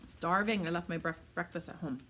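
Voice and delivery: talking through a mouthful of food; chewing while apologizing; wolfing down food. Real, not acted. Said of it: "Mm, so sorry, guys. Starving".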